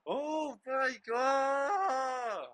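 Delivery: long drawn-out high shout of disbelief; stretched disbelieving shout. Real, not acted. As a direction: let it ring out in shock. Disbelief at the game's end.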